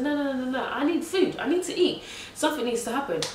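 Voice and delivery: high-pitched, insistent pleading; re-enacted indignant protest, pitch jumping up; urgent protest. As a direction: plead in high, rising bursts. Acting out her protest to midwife.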